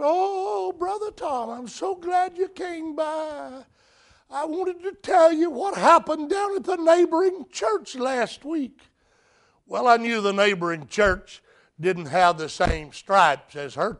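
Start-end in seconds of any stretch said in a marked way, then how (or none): 0.0-8.8 put-on much higher, softer old woman's squeak; squeaky old lady. Preacher imitating the elderly Miss Laddie greeting him, high and squeaky. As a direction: voice the elderly lady's high little voice. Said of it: "Her voice was beginning to sort of squeak, is sort of high-pitched".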